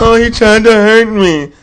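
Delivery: high, slow, whiny mock voice; hammed-up crybaby voice; mock whiner. Mocking Kevin Love as whiner.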